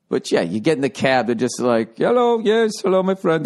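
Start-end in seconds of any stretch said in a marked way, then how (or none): 2.0-3.4 put-on higher cab driver hello. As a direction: play the indifferent cabbie, upbeat. Cab driver's greeting voiced.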